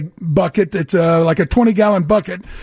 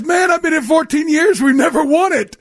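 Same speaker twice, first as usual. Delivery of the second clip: imitating a teammate shouting for joy; retold happy shout; 6 semitones higher than usual. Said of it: "He goes".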